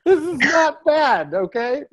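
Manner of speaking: giddy. Retold or imitated. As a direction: overcome with happy gratitude. Laughing gratitude in restaurant.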